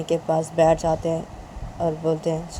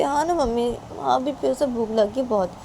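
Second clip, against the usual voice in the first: lightly voiced girl, higher pitch; higher, slightly pleading daughter; hungry daughter; 6 semitones higher than usual. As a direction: insist to mother in a raised pitch. Daughter insisting she is hungry.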